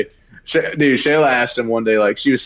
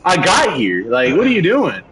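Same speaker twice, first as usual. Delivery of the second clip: exasperated outburst; 9 semitones higher than usual. Retold or imitated. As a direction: demand what he's doing, fed up. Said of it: "I'm like".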